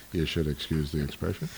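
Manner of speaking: tossing off a Yiddish-comic aside like Jackie Mason. Retold or imitated. Speaker quoting a comic to excuse his word, mock Jackie Mason. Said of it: "as Jackie Mason might say".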